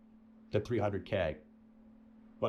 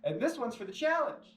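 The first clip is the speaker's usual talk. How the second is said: imitating Jimmy; 8 semitones higher than usual. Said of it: "And he goes".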